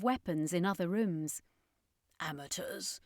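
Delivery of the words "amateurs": much softer scornful murmur; a private, quiet sneer; inner thought. A narrator's reading. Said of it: "thought to himself".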